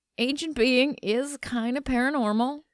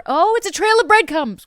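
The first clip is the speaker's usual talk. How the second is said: character line; 8 semitones higher, 5 dB louder than usual. Retold or imitated. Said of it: "you wanna just do that one more time?".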